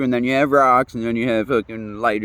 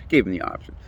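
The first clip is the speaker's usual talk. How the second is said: "Gave him the option" whispered through a laugh, much softer; breathy laughing repeat; 5 semitones lower, 4 dB quieter than usual. Real, not acted. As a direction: chuckle the words out under your breath. Repeats own line laughing.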